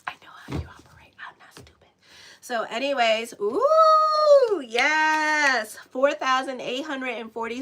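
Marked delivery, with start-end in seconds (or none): 0.1-1.9 hushed, breathy secret whisper, almost no voice. Pretend. Whispering a playful warning to viewers. 3.4-5.8 high squealing ooh, much louder; excited squeal. Real, not acted. Thrilled to see her subscriber count climb.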